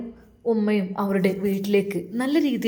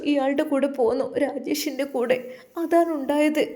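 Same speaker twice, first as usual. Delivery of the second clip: put-on higher, tearful young wife's voice; young woman confessing; 5 semitones higher than usual. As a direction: pitch up as the remorseful young wife pouring out her story. Narrator voices Zeenath confessing. (ഉയർന്ന സ്വരത്തിൽ കുറ്റബോധത്തോടെ ഏറ്റുപറയുന്ന യുവതി)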